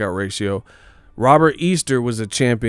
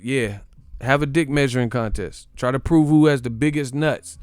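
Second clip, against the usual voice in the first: ordinary talk, nothing marked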